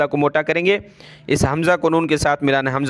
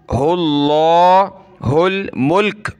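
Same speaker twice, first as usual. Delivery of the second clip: Quran recitation; much slower than usual. Shown as a demonstration. Teacher recites the verse slowly for pupils. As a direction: intone it slowly like tilawat.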